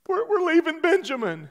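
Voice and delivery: high, mock-tearful wife voice; imitating crying wife. Husband mimics his tearful wife about leaving their son, high and mock-weepy. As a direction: play the upset wife with a hammed-up wail. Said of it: "Sarah is crying in the bedroom".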